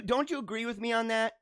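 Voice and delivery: ordinary talk, nothing marked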